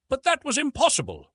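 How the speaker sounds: plain reading voice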